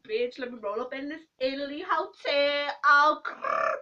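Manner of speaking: loud, cocky strut with a growl; mock-tough diva growl to finish; sassy swagger. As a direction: swagger in like you own the place. Rolling up to hotel, growling.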